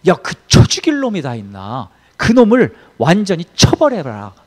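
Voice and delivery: acting out a king's sudden rage; loud mock-furious order, swelling as it goes; retold angry outburst. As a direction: imitate someone flaring up in anger. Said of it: "버럭 화를 내면서 그 자리에 벌떡 일어나요".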